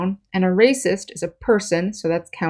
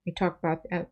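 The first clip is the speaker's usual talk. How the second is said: ordinary delivery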